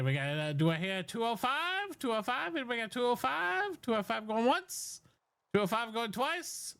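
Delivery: fast auction patter calling bids; auctioneer. Acted. Auction continues.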